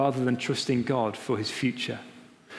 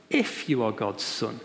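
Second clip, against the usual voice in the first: sly devil voice; 3 semitones lower than usual. Retold or imitated. Devil quoted again.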